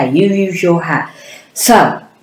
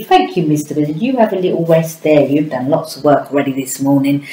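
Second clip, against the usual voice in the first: ordinary delivery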